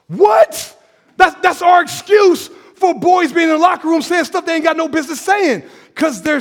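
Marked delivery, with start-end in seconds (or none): 0.1-2.5 high, loud rising what; shocked shout. Real, not acted. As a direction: react in outrage. Shocked reaction to excuse.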